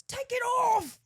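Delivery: loud, high British drill-sergeant shout; British accent yell. Acted. Staff officer ordering a contestant, shouted British accent. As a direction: bellow it like British military staff. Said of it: "that's my British accent".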